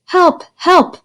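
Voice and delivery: high cry for help; a lost girl calling for help. A narrator's reading. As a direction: shout it from far down. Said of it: "a voice calling in the distance".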